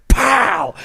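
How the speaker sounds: a punchy high "Pow!"; mock kick sound. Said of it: "I went".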